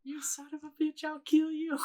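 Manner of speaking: high, softened mock scream; mock strangled rage. Replaying the strangling scene, high mock rage. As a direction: squeal the threat high without full volume.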